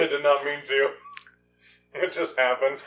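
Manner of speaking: words muffled by an ice cube; ice in mouth. Real, not acted. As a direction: talk through a chunk of ice. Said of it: "Sorry, I got an ice cube".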